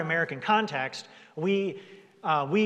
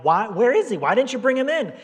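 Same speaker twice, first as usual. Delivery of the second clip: higher, louder exasperated outburst; indignant demand; 7 semitones higher, 7 dB louder than usual. Retold or imitated. Priests demanding why guards came back empty, loud indignant. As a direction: bark it like an irritated boss. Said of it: "And they're like".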